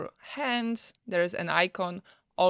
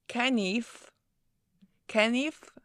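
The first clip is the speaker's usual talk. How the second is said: put-on Polish accent; 4 semitones higher, slower than usual. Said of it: "doing my best with my Polish accent".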